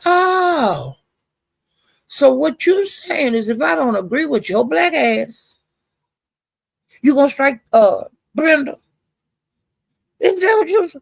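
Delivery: high-pitched, drawn-out mock disbelief; indignant challenge. Speaker confronting someone over striking Brenda, indignant.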